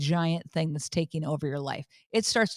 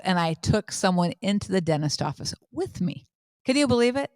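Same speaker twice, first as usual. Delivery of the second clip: normal talking voice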